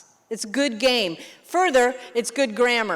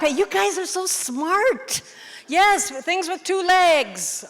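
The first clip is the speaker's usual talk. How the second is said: delighted praise; 7 semitones higher, slower than usual. Real, not acted. Praising students' smart guess.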